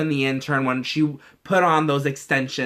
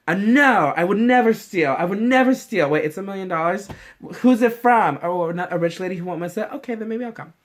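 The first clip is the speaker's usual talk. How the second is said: mock high-minded refusal; 5 semitones higher than usual. Joking about herself, a mock refusal that gives way to greed. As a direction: play the prim saint who folds for cash. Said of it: "I wouldn't be like".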